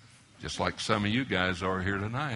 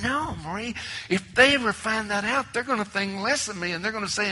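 lightly retold protest, pitched much higher; protesting husband; 10 semitones higher, 5 dB louder than usual. Preacher replaying his protest to his wife, higher. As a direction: argue back a bit louder and higher.

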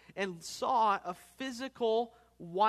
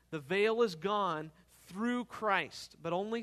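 louder, ringing pulpit voice; rising sermon fervor, pressing each phrase; full-force preaching; 2 semitones higher, 4 dB louder than usual. Acted. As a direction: preach it out with conviction.

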